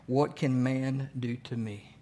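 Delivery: slow, each word laid down deliberately; slow scripture reading. Shown as a demonstration. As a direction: read "What can man do to me?" slowly, weighing every word. Preacher slowing a scripture quote.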